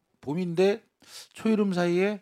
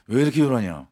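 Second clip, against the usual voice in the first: stern teacher; 5 semitones lower, 5 dB louder than usual. Retold or imitated. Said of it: "단호하게 말씀하셨어요".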